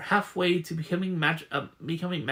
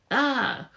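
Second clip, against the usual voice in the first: sharp high startled cry; short high yelp of fright; 8 semitones higher than usual. Acted. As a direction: jump at the sudden touch. Startled by someone in the dark.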